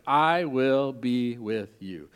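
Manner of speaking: spoken slowly, each word weighed; slow promise. Shown as a demonstration. Pastor slowly modelling the words for the congregation, tender. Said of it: "Will you say that with me?".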